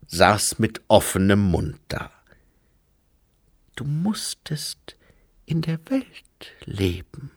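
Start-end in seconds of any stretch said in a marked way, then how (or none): 3.8-7.3 slow, half-whispered tenderness; as gently as possible. A narrator's reading. Susanne consoling Lobsang about his upbringing, very gentle and slow. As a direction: break it softly, with careful pauses. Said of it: "so sanft wie möglich".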